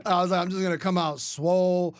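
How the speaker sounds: loud amused brag; laughing. Real, not acted. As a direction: laugh it out at the memory. Laughing at own plan.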